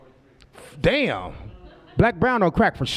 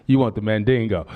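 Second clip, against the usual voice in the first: mock low seductive come-on; 9 semitones lower than usual.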